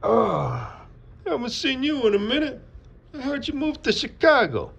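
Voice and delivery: very high, loud mock New York accent; attempted New York accent. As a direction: greet her in a squealed, uncertain New York accent. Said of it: "is that a New York accent?".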